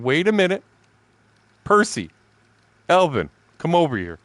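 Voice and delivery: high, loud call across the shop. Retold or imitated. Calling coworkers over to the machine, a loud high call. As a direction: summon them over, loud and sharp.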